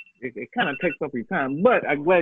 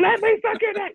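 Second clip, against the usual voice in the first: much higher squeaky come-on; high mock voice. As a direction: go way up and beg playfully. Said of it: "that's what I'm saying".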